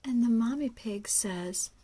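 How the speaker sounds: usual speaking voice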